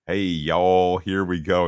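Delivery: imitating a Brit faking a Southern accent; imperfect Southern accent. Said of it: "slightly imperfect Southern accent".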